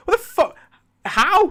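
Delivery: baffled, demanding an answer; incredulous outburst. Real, not acted.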